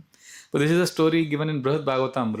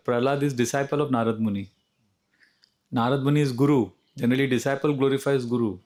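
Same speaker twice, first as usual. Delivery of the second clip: ordinary talk, nothing marked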